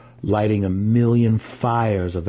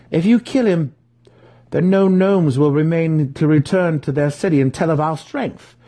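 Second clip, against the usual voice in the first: put-on higher, reasoned young drow voice; reasoning drow; 9 semitones higher, 4 dB louder than usual. Drizzt arguing with his brother to spare the gnome, earnest higher voice. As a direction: argue the point urgently. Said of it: "Drizzt reasoned".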